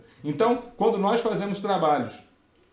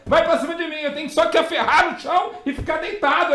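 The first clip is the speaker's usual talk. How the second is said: higher and louder, racing panic with nervous laughs; panicked inner monologue; 8 semitones higher, 6 dB louder than usual. Retold or imitated. Rookie inspector sees the gun come out, high and loud panic. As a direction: relive the fear of a gun drawn on the table.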